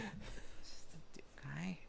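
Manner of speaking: hushed unvoiced whisper. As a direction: breathe it without voice. [name] whispers on seeing the guy.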